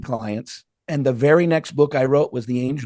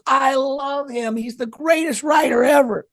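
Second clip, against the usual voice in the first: much higher, slow, adoring praise; adoring fan; 11 semitones higher, slower than usual. Retold or imitated. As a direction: draw out the fan's worship of the author. Voicing fan praising the author.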